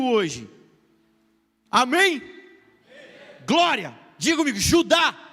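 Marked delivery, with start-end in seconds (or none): 1.7-5.3 higher, louder rallying call. Shown as a demonstration. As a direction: rouse the crowd to answer. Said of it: "Não, vamos lá, gente".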